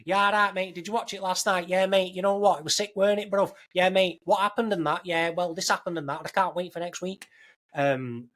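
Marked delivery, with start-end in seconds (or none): 0.0-7.3 high-pitched, hammed-up Salford accent. Salford workmates raving about last night's episode, hammed-up Salford accent. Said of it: "I was actually working in Salford".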